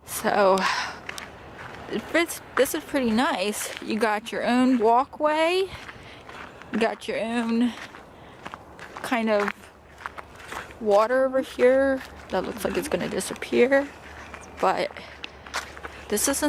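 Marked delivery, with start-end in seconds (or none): none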